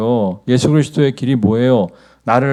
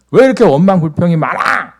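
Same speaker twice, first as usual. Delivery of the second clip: loud, high-pitched exasperated yell; angry Moses shouting; 8 semitones higher, 8 dB louder than usual. Retold or imitated. Moses losing his temper at the complaining Israelites, angry shouting. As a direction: yell it as an acted scolding of complainers. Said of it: "소리를 지르면서".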